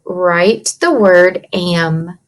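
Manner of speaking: slow, drawn-out dictation of write the word am. Shown as a demonstration. Teacher to class, slow dictating voice. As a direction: spell it out slowly for young writers. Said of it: "what we're gonna do".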